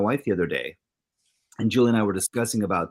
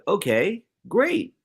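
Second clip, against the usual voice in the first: sarcastic okay great; 10 semitones higher, slower than usual. Retold or imitated. Sarcastic reaction to a text.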